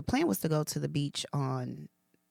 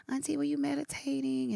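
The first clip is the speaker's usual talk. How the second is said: imitating the girls; 10 semitones higher than usual. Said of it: "the girls were like".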